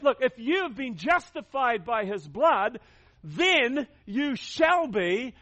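deliberate preacher's emphasis; emphatic preaching. Real, not acted. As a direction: hammer each word home. Preacher nailing home the point.

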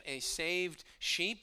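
usual speaking voice

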